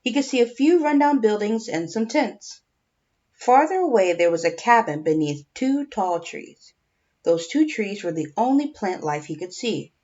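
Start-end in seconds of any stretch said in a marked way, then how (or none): none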